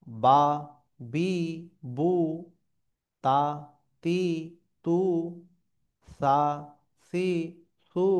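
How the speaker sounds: each syllable alone, loud and lengthened, with pauses between; teacher's loud, measured syllable recitation; pronunciation drill. Shown as a demonstration. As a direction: model the Arabic letters one stretched syllable at a time. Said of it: "আপনাদেরকে উচ্চারণগুলো আমি একবার বলে দিই".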